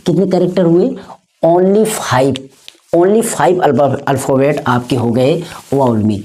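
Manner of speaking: usual speaking voice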